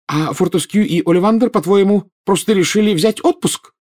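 indignant mother, high-pitched challenge; flaring up. A narrator's reading. Mother rebukes her joking son, indignant. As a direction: shoot back hotly, pitch jumping up. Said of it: "немедленно вспыхнула миссис Уизли".

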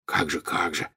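low-key, soft murmured whisper. A narrator's reading. Ron answers while secretly following Malfoy, whispering. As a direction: keep it right down, barely above a whisper. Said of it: "прошептал Рон".

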